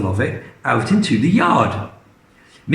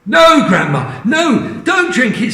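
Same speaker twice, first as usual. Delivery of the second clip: alarmed plea; 9 semitones higher than usual. Acted. George begging Grandma not to drink, high urgent. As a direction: stop her in a panic. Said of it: "said George".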